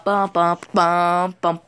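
normal talking voice

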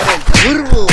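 loud shout swelling at the end; hammed-up battle cry; calling out turbo. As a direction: announce it big, belting the last word. Shows off toy, calls turbo.

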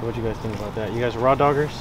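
ordinary delivery

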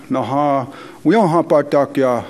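ordinary talk, nothing marked

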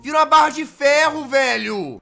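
high, loud thrilled exclaim; delighted sudden realization; excited discovery. Real, not acted. Discovering he can make iron.

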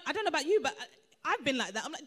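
ordinary delivery

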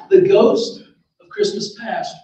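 plain reading voice

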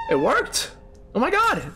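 high-pitched amazed cry; astonished. Real, not acted. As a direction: gasp it out in disbelief. Surprised that the item worked.

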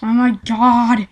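ordinary talk

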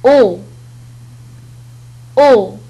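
"أو" said as a rounded French O; teacher modelling "أو"; French sound demo. Shown as a demonstration. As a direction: model "أو" loud and clear. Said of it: "تنطق".

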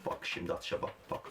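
hushed whisper. Real, not acted. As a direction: breathe it so nobody hears. Whispering during the throw. (fısıltı)

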